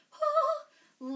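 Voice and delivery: high loud whoop of relief; excited whoop. Real, not acted. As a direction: let out a big whoo after the burn. Relief after hard ab set.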